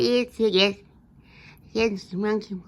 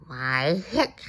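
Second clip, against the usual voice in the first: hiccups; 8 semitones lower than usual. Acted. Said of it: "you can't sing with the hiccups".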